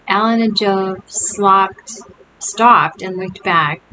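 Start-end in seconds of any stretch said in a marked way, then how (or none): none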